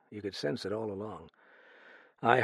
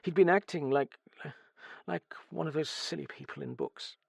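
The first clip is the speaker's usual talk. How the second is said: higher-pitched, halting bitterness; bitter; 7 semitones higher than usual. A narrator's reading. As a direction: start strong, then falter with bitterness.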